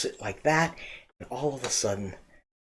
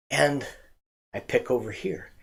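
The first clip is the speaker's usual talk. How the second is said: ordinary talk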